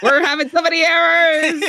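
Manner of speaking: high and loud, laughing through the words; half laugh, half wail at the bugs; cracking up in frustration; laughing despair. Real, not acted.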